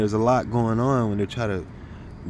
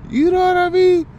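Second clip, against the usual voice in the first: excited shout; 8 dB louder than usual. Real, not acted. Excited about the revelation.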